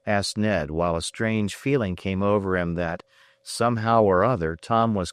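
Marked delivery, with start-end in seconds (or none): none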